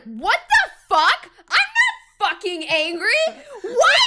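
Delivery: high, loud outraged yell with a laugh; indignant high-pitched shout, half laughing; mock furious kid. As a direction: play your kid self exploding at the nickname. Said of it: "I was so mad".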